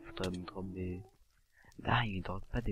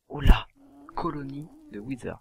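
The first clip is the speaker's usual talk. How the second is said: sudden loud, higher-pitched exclamation; startled; 7 semitones higher, 16 dB louder than usual. Real, not acted. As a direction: call it out in alarm. Startled by sudden game sighting. (surpris, cri d'alerte)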